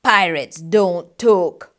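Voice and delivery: slow, low firm refusal; slow growled pirate captain; gruff pirate. Acted. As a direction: put the boy in his place. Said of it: "he bellowed".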